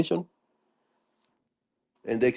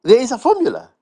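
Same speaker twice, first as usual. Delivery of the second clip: high, loud mocking repeat; sarcastic; 10 semitones higher, 3 dB louder, faster than usual. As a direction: scoff at the idea of a formula. Mocking the formula idea.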